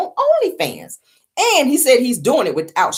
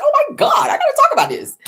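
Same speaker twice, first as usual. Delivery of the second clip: laughing excitement. Retold or imitated. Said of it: "I said".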